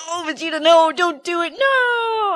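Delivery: high, wailing plea to stop; hammed-up high "no" of dismay; mock pleading. Acting out viewers pleading with Vegeta.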